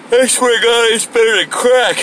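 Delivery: words stretched by a yawn. Real, not acted. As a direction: speak mid-yawn. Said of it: "I yawned while I said that".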